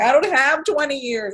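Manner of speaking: high, insistent complaint; exasperated protest. Retold or imitated. Arguing with the voice that she is forty, high exasperated complaint. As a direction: repeat the objection, fed up. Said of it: "I'm like".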